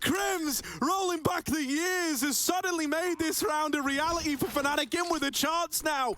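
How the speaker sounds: excited shouting play-by-play; esports caster. Real, not acted. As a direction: rise up and shout the kill. Caster reacts to sudden double kill.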